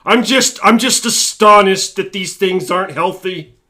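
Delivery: loud, high sarcastic shock; mock astonishment. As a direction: act utterly boggled, hammed up. Mocks people shocked by unhealthy products.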